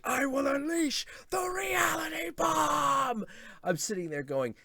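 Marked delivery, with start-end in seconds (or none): 0.0-3.2 high, loud mock villain shout; hammed-up Davros declaring his doomsday weapon. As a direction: bellow it like a mad supervillain. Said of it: "the minute Davros is like".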